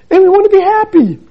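sing-song mock delight; mock giddy wish. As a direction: lampoon the dream of an easy life. Mocks retirees' wish for ease.

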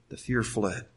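soft and slow, letting the words sink in; hushed repetition. Acted. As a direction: drop to a near hush and slow right down. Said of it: "repeated, soft and slow for emphasis".

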